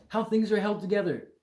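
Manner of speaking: loud, high mocking quote; exaggerated shouted imitation; mock blurting. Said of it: "I'm just gonna type in".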